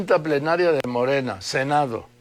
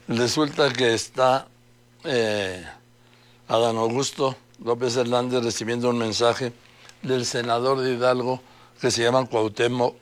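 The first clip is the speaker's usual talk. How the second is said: ordinary talk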